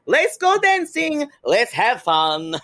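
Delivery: high, drawn-out DJ hype shout; hammed-up slow party-starter voice; bad house music DJ. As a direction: belt it like a cheesy club DJ. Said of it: "feels like a bad house music D J goes".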